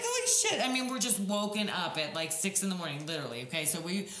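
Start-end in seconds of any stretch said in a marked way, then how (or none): 0.0-0.7 high, louder mock-alarmed exclamation; panicked curse. Retold panic at cops news.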